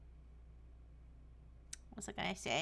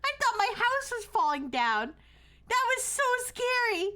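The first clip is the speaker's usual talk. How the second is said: shaken; 9 semitones higher, 3 dB louder than usual. Real, not acted. Shaken after a fright.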